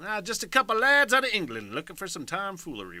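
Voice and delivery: put-on hammy old-timey English lad. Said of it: "the weird old-timey voice".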